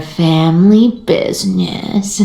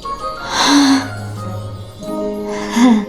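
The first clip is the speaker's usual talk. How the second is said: long, soft exhaled sigh; drawn-out breathy sigh; weary sigh; 4 semitones higher, 5 dB quieter than usual. Acted. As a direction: let out a long, quiet sigh. Weary reaction to advice.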